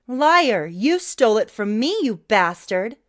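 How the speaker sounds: loud outraged yelling; furious shout. A narrator's reading. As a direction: scream accusations at the screen.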